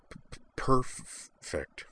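stuttered false starts, voiceless and drawn out; stammered sputter. Acted. Kate, hearing of the duel, stammers her reaction. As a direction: sputter on the first sound before getting it out. Stammering after bad news.

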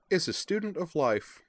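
high, grandly proclaimed; a lofty high-pitched flourish. A narrator's reading. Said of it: "Edward replied grandly".

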